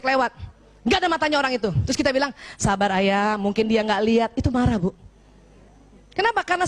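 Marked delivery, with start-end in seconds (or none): 2.6-4.3 soothing wife. Retold or imitated. Wife calms angry husband.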